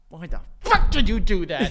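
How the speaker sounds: slow, exasperated husband's demand; drawn-out, hammed-up indignation; mock outrage. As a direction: play the fed-up husband confronting his wife. Acted angry husband confronting wife.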